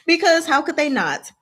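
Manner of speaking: ordinary talk, nothing marked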